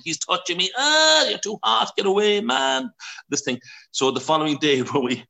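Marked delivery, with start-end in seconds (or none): 0.0-2.9 put-on high, loud whiny kids' yelling; kids squabbling. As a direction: squeal like a sunburned child in a crowded bed. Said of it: "screaming and crying".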